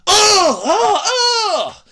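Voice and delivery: high, loud hammed-up gasps of an old dub; mock the old cartoon's exclamations. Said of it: "They talk like that".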